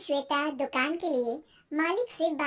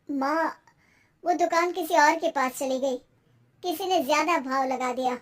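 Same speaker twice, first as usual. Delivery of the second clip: dejected son; 3 dB louder than usual. Acted. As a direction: break bad news to your mother.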